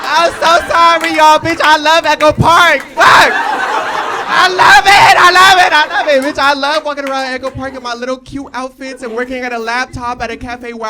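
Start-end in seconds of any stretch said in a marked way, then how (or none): 0.0-6.4 gleeful shout. Real, not acted.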